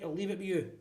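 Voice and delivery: ordinary talk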